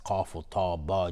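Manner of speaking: normal talking voice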